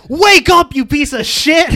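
high, loud barked order; mock cop shout. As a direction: yell it like a SWAT cop. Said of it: "It's like".

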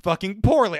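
worked-up warning to a friend; emphatic warning. Retold or imitated. Retold warning at sixteen.

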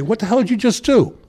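a retold challenge to the boss, voice jumping high; retold outrage.

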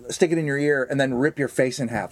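ordinary delivery

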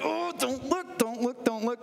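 hammed-up don't-look whimper; mock squeamish plea. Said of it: "it's like".

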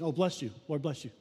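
offhand bless you. Shown as a demonstration. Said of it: "we say it almost matter-of-factly".

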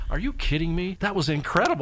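high-pitched mild disbelief; a lightly retold, incredulous rave; amazed reaction. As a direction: act out being impressed, voice lifted. Host reenacts his amazed reaction.

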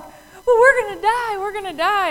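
high, loud panicked wail; imitating terrified followers, shrill with alarm; mock freak-out. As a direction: cry out as if doomed, hammed-up. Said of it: "they're all freaking out".

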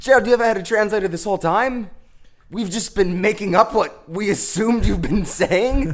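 incredulous. Acted. Host shocked there is a translator.

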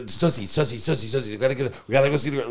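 eager repeated chant, mildly hyped; imitating excitement. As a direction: babble like an overexcited rookie. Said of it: "it was very exciting for him".

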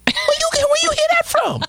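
a mock-horrified father squeal; imitating shocked dad. Father imagining his kid asking the word, in mock shocked outrage. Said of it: "I'd be like".

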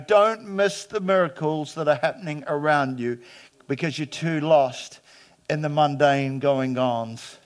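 ordinary delivery

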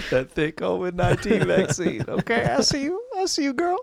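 squealed, high-pitched sassy catcall; mock admiration. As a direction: tease in a high put-on voice. Teasing admiring catcall riff.

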